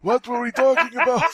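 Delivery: put-on high, confused old man voice; forgetful old-timer. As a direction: play a grandpa losing his thread.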